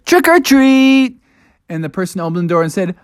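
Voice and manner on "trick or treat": high, loud, slow call; kids trick-or-treating. Acted. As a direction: sing it out at the door. Kids at the tenth house.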